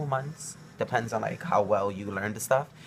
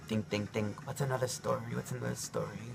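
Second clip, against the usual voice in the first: soft muttering to himself; thinking aloud; 9 dB quieter than usual. Real, not acted. Vlogger searching his memory for a story, softly muttered. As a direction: murmur it under your breath while searching. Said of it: "I'm, like, thinking of something".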